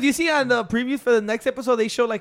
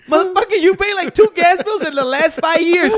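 higher, louder mock scolding; retold outburst; 7 semitones higher, 6 dB louder than usual. Telling friends how he scolded his roommate, loud. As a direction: call him out loud and high. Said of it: "And I'm like this".

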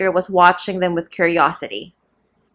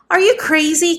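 crying out; 9 semitones higher than usual. A narrator's reading. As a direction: cry it out in alarm. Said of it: "cried the servant".

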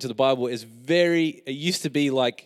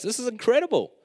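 amazed; 11 semitones higher than usual. Real, not acted. Amazed at scripture.

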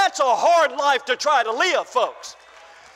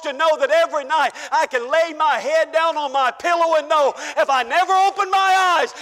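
ordinary talk, nothing marked

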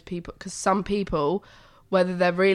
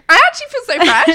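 higher, much louder chirpy boast with a laugh; mock chirpy; 11 semitones higher, 15 dB louder than usual. Mimicking her friend's chirpy morning boast, loud and laughing. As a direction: play up the smug cheerful friend. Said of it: "And you're like".

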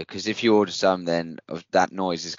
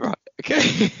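laughing. Real, not acted.

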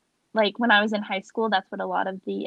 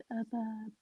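whispered; 15 dB quieter than usual. Real, not acted. Guessing the answer, unsure.

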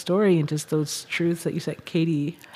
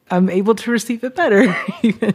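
higher, louder, laughing through the words; laughing while speaking; 5 semitones higher, 5 dB louder, faster than usual. Real, not acted. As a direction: let the laugh spill into the line.

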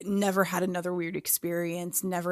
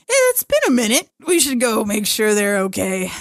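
loud, high mock grandparent voice; hammed-up, breezy suggestion; playful grandparents; 3 semitones higher, 9 dB louder than usual. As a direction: imitate fussing relatives, starting high and easing down. Voices the ghost grandparents checking in.

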